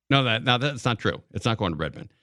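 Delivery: ordinary talk, nothing marked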